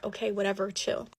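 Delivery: low, soft, dismissive mumble; nonchalant. Retold or imitated. Boyfriend brushes off meeting after practice, nonchalant. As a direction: imitate a boyfriend shrugging it off. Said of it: "he's being so nonchalant about it".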